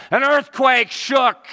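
high, loud and slow; dramatic shout. Acted. Preacher reading Mark's signs, shouting the earthquake. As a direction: boom it out like the ground shaking.

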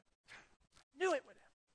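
choked up, voice catching; fighting back tears; voice breaking with emotion. Real, not acted. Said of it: "Sorry, I get a little emotional".

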